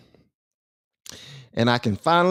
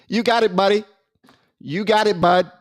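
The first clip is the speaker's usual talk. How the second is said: chipper buddy voice; 6 semitones higher than usual. Retold or imitated.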